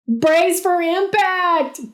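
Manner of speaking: slow, high dramatic yell; slow, high panicked yell. A narrator's reading. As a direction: drag it out like a movie hero. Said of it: "Kevin yelled".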